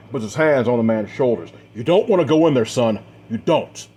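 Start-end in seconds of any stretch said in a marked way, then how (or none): 1.7-3.9 raised, louder plea to hold someone back; urgent warning. A narrator's reading. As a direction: firmly urge him not to go. Man urgently stops son entering tent.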